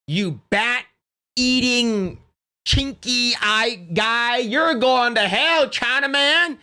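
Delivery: a mock condemning rant that rises in pitch as it goes; hint of a preachy scold, drawn out slowly on the insults; Melanie Mack impression. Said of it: "if Melanie Mack was in the chat, she would say".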